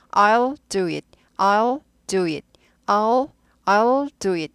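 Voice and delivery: "I'll do it" drawn out very slowly; slow drill. Shown as a demonstration. Teacher drilling the contraction slowly for students. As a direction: stretch each word of "I'll do it". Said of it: "이렇게 돼요".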